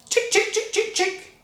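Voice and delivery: high, coaxing chick call; calling the hen. Acted. George coaxing the brown hen, high sing-song call. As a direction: coax the hen over. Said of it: "he said".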